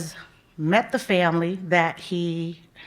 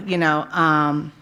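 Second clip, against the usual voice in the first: ordinary talk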